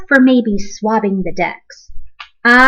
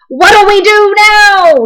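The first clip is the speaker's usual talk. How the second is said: high, loud, slow panicked yell; a pirate panicking in the storm; 10 semitones higher, 7 dB louder, slower than usual. A narrator's reading. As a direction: yell it in alarm. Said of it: "yelled one of the pirates".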